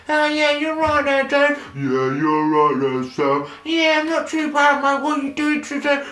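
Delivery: very high, loud chirpy Brit; cheery lager-lout banter; mock British holidaymaker. British family chatting by the pool, high hammed-up.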